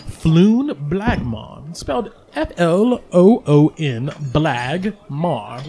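Floon Blagmar stretched slowly, each syllable spelled out; deliberate name repeat for the table; slow and clear. Shown as a demonstration. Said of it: "what was his name again?".

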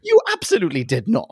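indignant comic rebuttal; incredulous contradiction. Retold or imitated. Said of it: "I was like".

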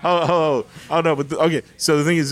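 ordinary delivery

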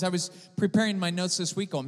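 ordinary talk

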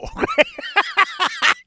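cackling laugh; a big real laugh; high burst of laughter. Real, not acted. Laughing at the blunt no.

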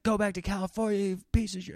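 high-pitched imitation of an irate driver; fierce cursing. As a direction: imitate a stranger cursing at you. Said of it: "he was so fierce. He's cursing at me".

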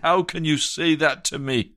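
high-pitched, bitter outburst at God; anguished shout. Retold or imitated. Preacher retelling his shout at God in pent-up anger, anguished and high. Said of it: "I shouted at God".

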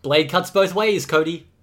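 loud, high, triumphant-sounding shout; big drawn-out shout, easing slightly; called out. A narrator's reading. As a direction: yell the count, then the taunt. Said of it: "shoved him and called out".

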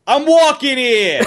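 high, slow, New York street yell; New York tough guy. Shown as a demonstration. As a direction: belt it like an angry New York pedestrian. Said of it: "repeat after me".

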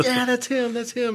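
high-pitched excited group reaction; imitated gleeful agreement. Students agreeing about Phil after laughing, imitated excitedly.

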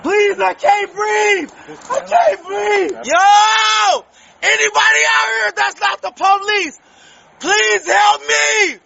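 slow, high, panicked screams for help; desperate screaming. Real, not acted. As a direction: scream it out, begging for air. Pinned, shouting for help.

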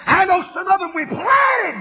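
high, loud crying preacher shout; full-force sermon wail; shouted preaching. Acted. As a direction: wail it out over the music.

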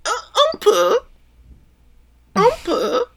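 very high, squeaky little French words. Acted. Answering whether he speaks French, in a high squeak. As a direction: squeak it out like an unoiled wheel. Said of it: "a bike with a squeaky wheel".

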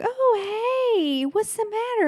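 high, sugary sweet-lady voice; sweet woman. Retold or imitated. Sweet woman greeting a frightened stranger, high and sugary. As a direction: coo it like a sweet-faced host. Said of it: "a sweet-faced woman is like".

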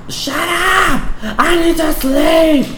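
very high-pitched screechy complaint; mock bitching. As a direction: whine it way up high, hammed-up. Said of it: "like, bitching about it and".

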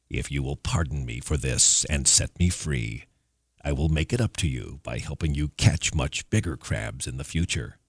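a sly demon speaking low; hill devil voice. A narrator's reading. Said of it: "said the monster".

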